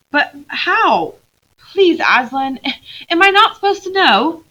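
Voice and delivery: Lucy begging Aslan, high and loud; pleading girl. Acted. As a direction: beg Aslan for an answer.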